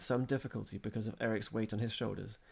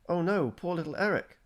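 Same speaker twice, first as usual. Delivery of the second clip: dismayed; 9 semitones higher, 8 dB louder than usual. A narrator's reading. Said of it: "the deep dismay in Bjorn's voice".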